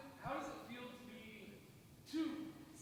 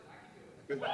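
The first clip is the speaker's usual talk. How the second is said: laughing; 11 dB louder, much faster than usual. Real, not acted. Laughing, loud person claim.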